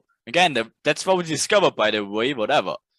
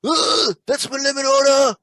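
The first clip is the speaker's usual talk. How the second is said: higher, louder mock rage; mad trader; 10 semitones higher, 4 dB louder than usual. Coach imitating a raging trader, high loud mock anger. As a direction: ham up a furious trader ranting at the chart. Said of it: "like a Mad Max".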